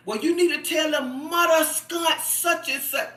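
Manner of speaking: put-on exasperated bossing; mad husband. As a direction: imitate his worked-up order in a changed voice. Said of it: "he get mad".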